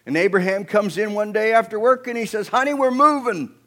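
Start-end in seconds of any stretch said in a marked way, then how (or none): 2.5-3.5 loud, raised-pitch husband's announcement; Abraham's announcement. Acted. Husband home after work telling his wife, loud announcement. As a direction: call it out high and loud.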